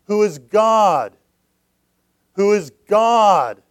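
loud, slow preaching cry; booming question. Acted. Preacher booming to the church, loud and slow. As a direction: boom it out to the congregation.

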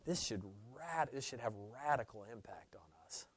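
quick hushed whisper. Real, not acted. Preacher telling believers the impact, fast whisper. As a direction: lean in and whisper the urgency.

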